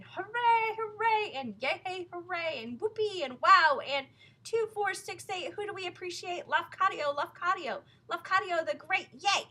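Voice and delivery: high, loud crowd cheers; crowd cheering. A narrator's reading. Parade crowd cheering for Lafcadio. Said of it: "the people were cheering".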